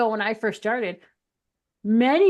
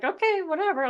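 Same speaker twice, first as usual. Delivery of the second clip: dismissive okay; 9 semitones higher than usual. Retold or imitated. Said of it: "I'm like".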